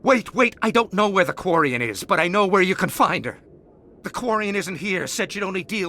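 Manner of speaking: desperate pleading. Acted. Cornered thug pleading.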